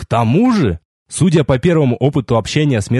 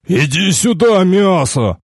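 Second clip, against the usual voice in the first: higher, annoyed summons; irritated order; 6 semitones higher, slower than usual. A narrator's reading. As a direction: order someone over with mild irritation. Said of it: "вновь процедил мужик". (раздражённый приказ подойти)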